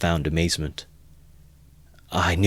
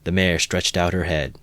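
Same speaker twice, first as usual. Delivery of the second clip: ordinary delivery, nothing marked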